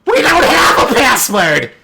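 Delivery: high, slow, gleeful holler; drawn-out shouted reveal; really loud creature shout. Acted. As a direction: bellow the punchline in a high creature voice. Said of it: "he says it really loud".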